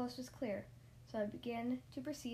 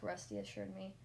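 ordinary delivery

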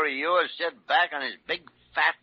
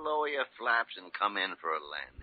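usual speaking voice

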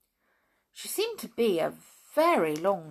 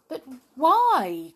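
louder, higher puzzled cry; surprised; 6 semitones higher, 8 dB louder than usual. A narrator's reading. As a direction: ask it in mild surprise. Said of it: "cried Jolly in surprise".

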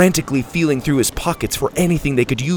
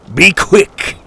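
louder, higher impatient pirate order; gruff captain; 6 semitones higher, 7 dB louder than usual. A narrator's reading. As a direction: bark at the girl to hurry.

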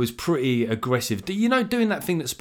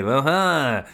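hammed-up French accent, higher and louder; 6 semitones higher, 4 dB louder, slower than usual. Picnickers taunting restaurants in a mock French accent. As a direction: put on a gloating French accent. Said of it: "it's hard to do a French accent".

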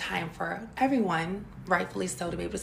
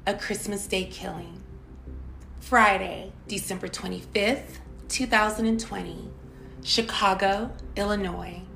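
true crime narrator. Acted. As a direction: announce the case like a crime show. Dramatic case opener and warning.

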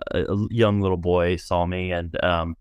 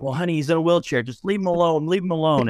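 higher, quick hushing mom voice, lightly voiced; flustered mom; 8 semitones higher, faster than usual. Mortified mother hushes her son in Target, higher and hurried. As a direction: shoo the kid along like a mortified mother. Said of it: "his mom is like".

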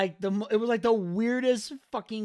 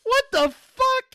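much higher, louder outraged yell; incredulous shout; 8 dB louder, slower than usual. Retold or imitated. Podcaster reliving the match, yelling at the TV. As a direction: shout it in disbelief, stretched out. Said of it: "and I'm like".

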